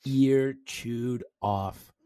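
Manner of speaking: normal talking voice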